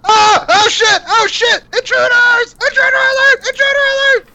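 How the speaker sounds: high squeaky panicked rat shout; squeaky bickering rat chorus. Acted. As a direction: shriek the alarm like a rat. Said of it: "And he's like".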